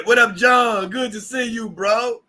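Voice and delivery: high, shouted hello; excited greeting. Real, not acted. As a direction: holler to a friend you're glad to see.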